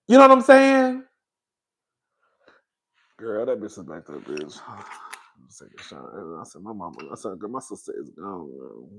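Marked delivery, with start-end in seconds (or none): 3.2-6.1 much lower and softer, almost a whisper; hushed aside. Real, not acted. Telling viewers who was pouring drinks, a hushed aside. 6.1-8.9 much lower and softer, almost a whisper; hushed aside. Retold or imitated. Telling viewers who was pouring drinks, a hushed aside. Hushed retelling of her reaction.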